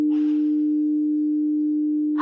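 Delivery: long, audible breath and sigh; soft, unhurried relaxation breath. Shown as a demonstration. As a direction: breathe slowly and audibly for the listener. Demonstrates breathing with the listener.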